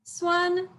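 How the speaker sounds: SUAN held high and level; steady, unchanging high "Suan"; first tone. Shown as a demonstration. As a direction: sustain "Suan" on one high pitch. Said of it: "it's the four tones".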